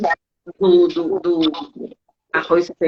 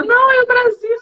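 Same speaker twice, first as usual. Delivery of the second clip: much higher and a touch louder, exclaiming; exasperated correction; 4 dB louder than usual. Retold or imitated. Brazilian corrects Spaniards mistaking her for Italian, high exclaim. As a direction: correct them with a high, indignant cry. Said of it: "Eu, quando fala".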